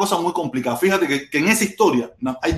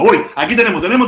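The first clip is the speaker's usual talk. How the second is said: ordinary delivery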